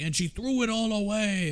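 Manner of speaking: high mocking imitation of him; mock quote. Podcaster mimicking the movie line, high and mocking. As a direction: echo his line in a lifted mock voice. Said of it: "He's just like".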